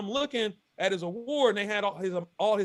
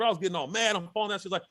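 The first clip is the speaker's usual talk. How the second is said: usual speaking voice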